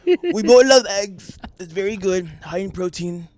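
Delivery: mock Trump mourning lost eggs; Trump impression. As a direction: boast like Trump at a rally. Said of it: "I don't actually have a really good Trump".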